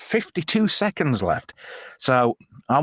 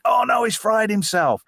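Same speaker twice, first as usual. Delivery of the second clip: high mock dismay; alarmed prison guards; 9 semitones higher than usual. Host voices prison guards finding him, high mock alarm. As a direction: imitate guards' alarm in a raised voice. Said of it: "and they think".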